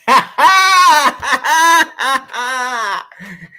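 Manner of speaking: a long, high, loud cackling laugh; loud laughing shriek. Real, not acted.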